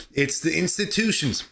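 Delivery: ordinary talk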